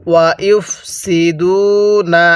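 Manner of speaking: slow drawn-out tajweed recitation; Quranic recitation. Shown as a demonstration. Teacher leading students to repeat, slow recitation. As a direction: stretch each syllable for the class to repeat. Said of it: "কিভাবে পড়ব".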